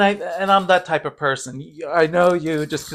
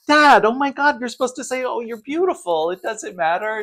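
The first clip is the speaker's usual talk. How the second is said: exasperated; 8 semitones higher than usual. Retold or imitated. Daughter scolding her father, hammed-up exasperation. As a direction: scold him in mock disbelief. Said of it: "And I'm all like".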